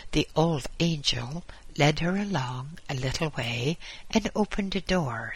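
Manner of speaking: ordinary delivery, nothing marked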